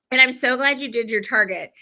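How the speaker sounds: ordinary talk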